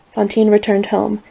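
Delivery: ordinary delivery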